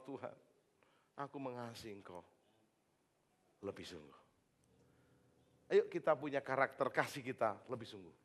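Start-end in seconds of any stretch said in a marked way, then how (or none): 0.0-4.2 soft and low, sinking at the end; soft confession. A narrator's reading. Peter answering Jesus, soft and low. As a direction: confess it quietly, like Peter ashamed.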